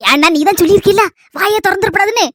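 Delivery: normal talking voice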